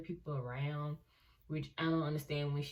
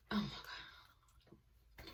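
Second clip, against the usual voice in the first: whispered slow oh my God; soft slow whisper; breathy dread; 10 dB quieter, much slower than usual. Real, not acted. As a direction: hush it, dreading the memory. Dread before bad story.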